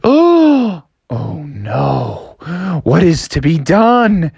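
theatrical gasp of a crowd; a touch of hammed-up dread, drawn out; mock dismay. A narrator's reading. Townsfolk seeing the smoking mountain, mock horrified.

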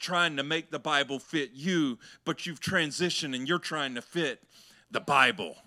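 loud, building preacher delivery; preaching. Acted. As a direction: preach it with rising force. Preaching builds to full force.

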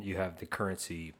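normal talking voice

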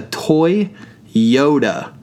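Toy, a beat, then a drawn-out Yoda; split punchline. Real, not acted. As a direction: pause between Toy and Yoda. Punchline splitting the word Toyota.

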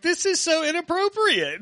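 high, scandalized whine; husband shocked by a flirting chef, high and prissy; mock prissy shock. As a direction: squeal it like a pearl-clutcher. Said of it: "And I was just like".

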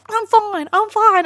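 high, brushing-off I'm fine; injured rider waving off help, mock-stubborn; stubborn victim. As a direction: insist like a dazed rider. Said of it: "And he's like".

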